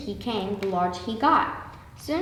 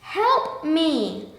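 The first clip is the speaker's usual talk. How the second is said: mild shout; 6 semitones higher than usual. A narrator's reading. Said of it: "She shouted in her very loudest voice".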